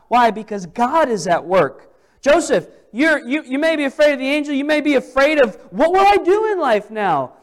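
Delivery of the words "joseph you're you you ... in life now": higher, louder, fast pleading to Joseph; impassioned appeal. Acted. Preacher speaking to Joseph, impassioned higher appeal. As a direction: press him urgently.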